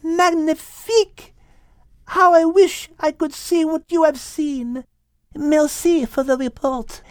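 put-on high voice with a French accent; little French cat, pleased with her friend; French-accented Liz. Liz thanks the dove.

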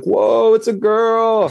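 high, loud gasp of delight; excited crowd. Retold or imitated. Crowd in the sauna reacting to the reveal, excited high shout. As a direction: shout it out like the whole crowd cheering.

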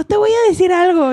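high, mocking quote of a friend; exaggerated friend's tone. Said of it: "no te lo dije con este tono". (tono agudo e imitado)

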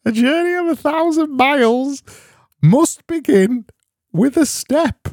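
comic high-pitched wise man voice; very high put-on voice. Shown as a demonstration. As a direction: go way up into a tiny squeaky voice. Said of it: "Let me try this".

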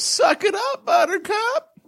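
way up high, a singsong tease; mock cheery taunt. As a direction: chirp it like a teasing nanny. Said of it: "what I said to her was".

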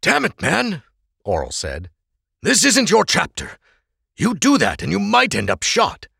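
lightly voiced Oral, sharp and urgent; scolding friend. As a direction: warn a friend off danger.

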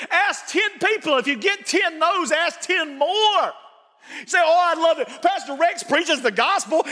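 preaching at full force. Acted. Pastor urging church members to keep inviting, fiery preaching. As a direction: pastor pressing the church to invite non-believers, fervent preaching.

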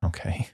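a put-upon sigh before agreeing; resigned sigh. Retold or imitated. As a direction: give in with a sigh. Reluctantly obeying Jesus' "closer.

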